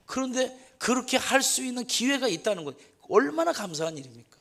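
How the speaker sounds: fervent sermon, voice lifted high; impassioned preaching. Acted. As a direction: press the congregation with feeling. Sermon climax continues.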